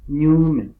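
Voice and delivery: Ñume with a loud, held ÑU; stress on first syllable. Shown as a demonstration. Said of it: "acento ortográfico". (Ñume con la ÑU más fuerte)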